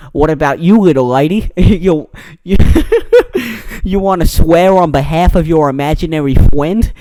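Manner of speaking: loud cartoony menace, cracking up midway; bad Boston heavy turned cartoon, breaking into laughter; Looney Tunes hunter. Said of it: "sounding like that Looney Tunes character, the Hunter".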